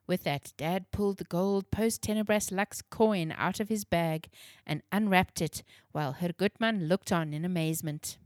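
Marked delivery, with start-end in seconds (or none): none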